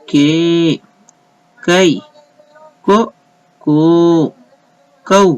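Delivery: slow recitation with long vowels held and lifted; कू and के drawn out, कु and कृ kept short; syllable drill. Shown as a demonstration. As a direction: recite each syllable like a chart for learners. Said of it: "उसे कम खींचते हैं".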